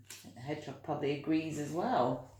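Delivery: plain reading voice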